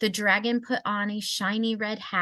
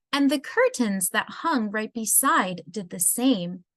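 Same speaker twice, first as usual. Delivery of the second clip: ordinary delivery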